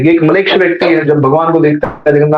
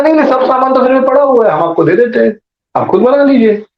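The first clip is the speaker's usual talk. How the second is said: higher-pitched, hurried host's insistence; insistent offer; 8 semitones higher than usual. Retold or imitated. Host offering provisions to a guest, insistent. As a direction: press the offer eagerly, pitched up.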